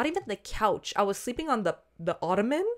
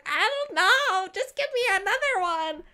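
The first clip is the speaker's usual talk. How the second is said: put-on drunk whine; 12 semitones higher than usual. Answering the guy about the dropped pill, high drunk whine. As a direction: whine it way up like a wasted kid.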